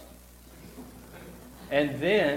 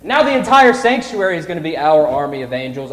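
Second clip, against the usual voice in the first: ordinary delivery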